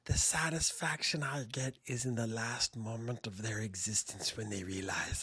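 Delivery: slow, soft, sinister whisper; cute voice turned menacing; creepy cute whisper. Shown as a demonstration. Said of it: "I can use this voice and I can say horrible, horrible things".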